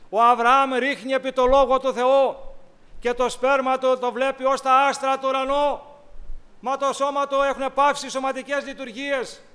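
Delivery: loud, fervent sermon delivery; preaching. Acted. Preacher proclaiming God's promise to Abraham, loud and fervent. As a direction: proclaim it to the whole church.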